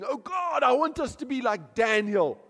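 high, loud pleading prayer; fervent prayer. Retold or imitated. Pastor retelling his prayer, high fervent pleading. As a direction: plead it up to God. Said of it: "I prayed".

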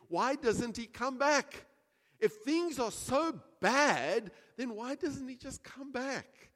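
slow, loud preacher's challenge; impassioned question. Acted. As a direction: press the question on the congregation.

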